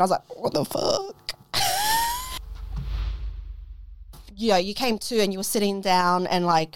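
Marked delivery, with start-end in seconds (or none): 0.4-1.2 high squeaky disbelief; shocked. Retold or imitated. Recalling being told it's her turn, high shocked disbelief. 1.5-4.0 very high breathy giggle; high squeal laugh. Real, not acted. Laughing at her own story, high squealing giggle. Laughs after retold reaction.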